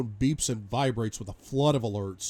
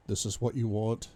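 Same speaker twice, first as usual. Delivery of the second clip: plain reading voice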